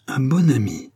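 incredulous, contemptuous echo; scornful exclamation. A narrator's reading. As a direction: throw the words back with disdain. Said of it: "s'écria la reine avec une nouvelle explosion de mépris".

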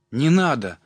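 high, loud cry of protest; boy's outcry. A narrator's reading. Колька begs his father to stop dancing, crying out. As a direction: burst out, begging it to stop. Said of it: "закричал".

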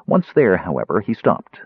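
ordinary delivery

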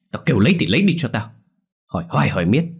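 sharp cut-off. Acted. Husband snaps an order at his wife. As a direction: bite the order out at her. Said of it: "Ông Tình lập tức cắt giọng".

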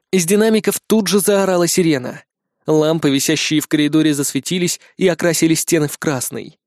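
ordinary delivery, nothing marked

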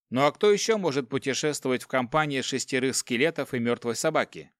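ordinary delivery

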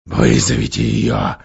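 grey demanding voice. Acted. Werewolf to the hag, eerie demand. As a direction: demand it coldly. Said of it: "потребовал серый голос".

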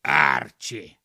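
very low, loud put-on voice; deep creature voice; mocking skunk. A narrator's reading. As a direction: say the name deep and heavy, as a talking skunk. Skunk repeats the odd name.

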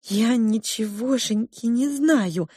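trembling. Acted. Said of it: "задрожала кухарка".

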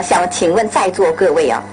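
ordinary delivery

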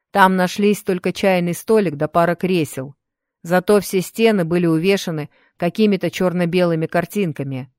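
ordinary delivery

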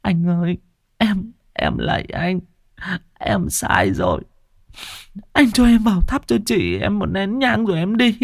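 high-pitched, whimpering plea; tearful pleading. Acted. Sister-in-law begging to light incense for her dead sister, tearfully whimpering. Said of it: "bà Loan chỉ biết mếu máo".

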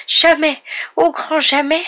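very high, loud creature wail; noisy sobbing wail. A narrator's reading. Elf overwhelmed by kindness, loud high wailing. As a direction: wail it at full pitch. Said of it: "éclater en sanglots, des sanglots particulièrement bruyants".

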